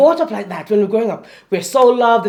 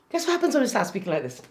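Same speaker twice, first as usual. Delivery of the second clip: quick and much softer; hushed question; 8 dB quieter, faster than usual. Shown as a demonstration. Coach putting the question again to guests, hushed. Same question repeated quietly, contrast.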